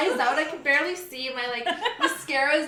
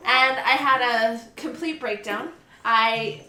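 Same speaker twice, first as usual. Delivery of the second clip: ordinary talk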